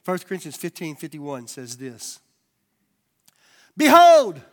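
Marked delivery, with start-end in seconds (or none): 3.7-4.4 loud, high proclamation; booming Behold; proclaiming. Acted. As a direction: ring it out like a herald. Reading scripture, Behold.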